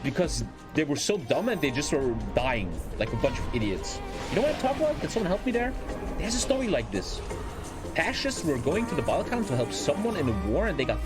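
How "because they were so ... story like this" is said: ordinary talk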